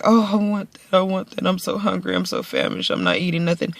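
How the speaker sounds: mock whiny hungry. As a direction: play a hungry whiner she is not. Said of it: "It's not that I'm hungry and I'm like".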